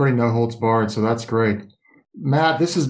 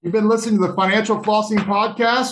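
announcer voice; 7 semitones higher than usual. Acted. Host outro announcement.

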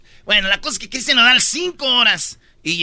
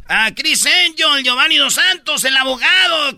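high-pitched list of taunts; imitated heckling; 6 semitones higher than usual. Imagined fans keep heckling the singer, high calls. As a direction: call out names like a jeering crowd. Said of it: "le hubieran seguido ahí con".

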